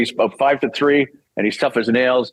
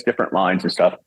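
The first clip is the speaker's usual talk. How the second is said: ordinary delivery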